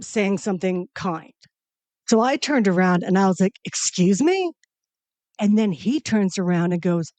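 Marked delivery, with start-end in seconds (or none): 3.6-4.5 indignant excuse me. Retold or imitated. Confronting him on the street.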